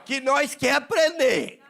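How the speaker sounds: high, slow country Minas Gerais drawl; rustic mineiro accent. Pretend. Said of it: "fala que nem o mineiro da roça".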